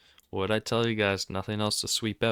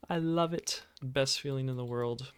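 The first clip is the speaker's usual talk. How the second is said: sarcastic; 5 semitones higher than usual. Real, not acted. Sarcastic about no trades.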